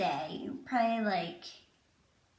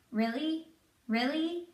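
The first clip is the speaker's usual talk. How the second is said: higher, questioning disbelief; stunned husband; 6 semitones higher than usual. Retold or imitated. Deployed husband learning of pregnancy, mild disbelief. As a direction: lightly imitate someone taking in big news.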